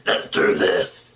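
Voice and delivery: slow whispered monster growl; breathy slow monster line. Shown as a demonstration. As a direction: menace it out slowly like a monster. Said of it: "monster character".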